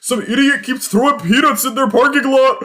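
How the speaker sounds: high, loud crow sneer; mocking crow. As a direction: scoff at the humans like a crow. Crow brothers mocking humans.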